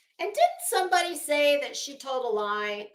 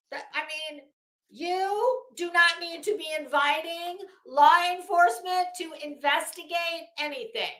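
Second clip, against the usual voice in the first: ordinary delivery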